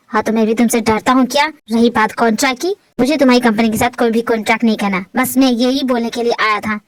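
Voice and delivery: low, firm man's voice; defiant Mister John. Acted. Visitor rejecting the contract to Saniya's face, low and defiant.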